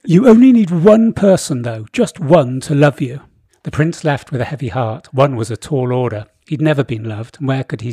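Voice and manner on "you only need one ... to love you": raised, lordly King Boris voice; booming royal decree; pompous king voice. Acted. Boris again.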